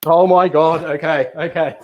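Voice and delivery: loud high flustered laugh; panicked but laughing. Real, not acted. Clock running out, flustered.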